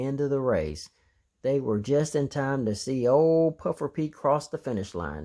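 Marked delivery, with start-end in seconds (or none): none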